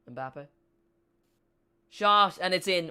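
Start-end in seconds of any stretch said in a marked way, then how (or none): 1.9-2.9 sudden loud rise for the goal; goal call. Real, not acted. Streamer calling the goal to viewers, excited shout. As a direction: shout it as the ball goes in.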